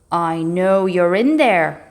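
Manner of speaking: a slow, loud rumbling dragon voice; lightly voiced dragon rumbling from outside; deep rumble. A narrator's reading. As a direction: rumble it out like a big beast. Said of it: "rumbled a very deep voice".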